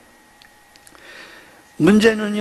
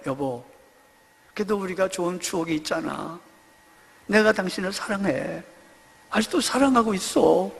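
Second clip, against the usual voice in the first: soft, quieter loving words to his wife; tender plea; 2 semitones lower, 5 dB quieter than usual. Retold or imitated. Husband pleading with his wife in court, soft and tender. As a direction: coax her back, gently.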